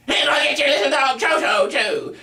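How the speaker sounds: very high, strident, piercing screech; high-pitched piercing villainess; Wicked Witch. Shown as a demonstration. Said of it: "as the Wicked Witch in The Wizard of Oz".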